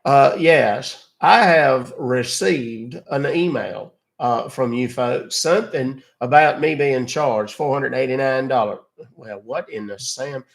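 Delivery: put-on Southern drawl, blustery caller; hammed-up cartoon Southern voice; Foghorn Leghorn. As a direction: play a folksy Southern gentleman. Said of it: "They don't even like Foghorn Leghorn".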